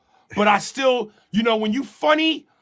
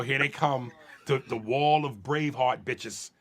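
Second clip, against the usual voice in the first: normal talking voice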